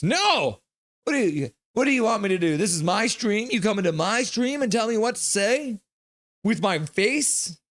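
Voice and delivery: high-pitched, hammed-up protest; mock indignation. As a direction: protest in playful outrage at a bossy viewer. Mock quarrel with a chat demand.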